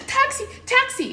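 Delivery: high shout across an empty airport; calling out. Retold or imitated. Mother searching the airport for a taxi, high calling shout. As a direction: flag someone down from afar.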